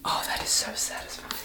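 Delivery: breathy whisper close to the mic; ASMR whisper. Real, not acted. To viewers listening to the shower sizzle, soft whisper. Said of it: "auditory experience".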